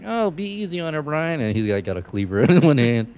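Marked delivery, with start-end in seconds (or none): 0.0-1.4 high-pitched mock mom voice; lightly hammed-up mother's plea; pleading mom. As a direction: gently plead with your son. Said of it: "She's like".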